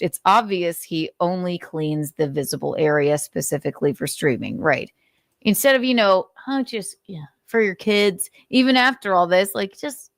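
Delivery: ordinary talk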